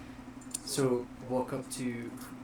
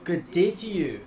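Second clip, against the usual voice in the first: formal elf greeting; 4 semitones higher, 6 dB louder than usual. Acted. Greeting NPC in character.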